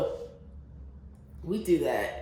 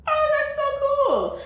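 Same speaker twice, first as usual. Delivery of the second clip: very high, loud gushing; re-enacted delighted squeal; excited squeal; 6 dB louder than usual. Replaying her reaction to meeting someone like her, excited.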